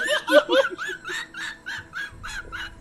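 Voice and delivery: long squeaky rising laugh; high-pitched laughter. Shown as a demonstration. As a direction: cackle way up high like on helium. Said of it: "this really high pitched kind of laughter".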